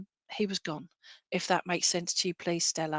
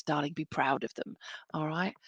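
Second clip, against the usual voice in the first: ordinary talk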